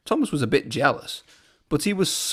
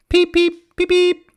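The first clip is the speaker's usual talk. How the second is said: very high, loud whistle-like peeps; piercing loud peep peep; 8 dB louder than usual. A narrator's reading. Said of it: "whistled Thomas".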